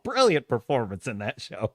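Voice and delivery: ordinary talk, nothing marked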